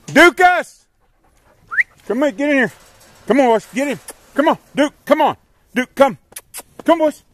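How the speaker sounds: high-pitched call to the dogs. Real, not acted. Calling dogs into pen.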